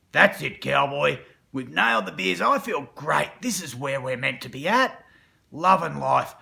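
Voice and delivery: loud gloating celebration; dark side gloating after all eight beers; gleeful dark side. Acted.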